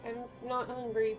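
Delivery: normal talking voice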